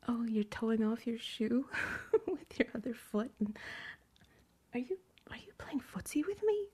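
usual speaking voice

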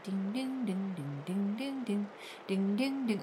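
soft, repeated dinging; imitated bell. Host taking bets on the casket, soft imitated dinging. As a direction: chime quietly like a game-show bell.

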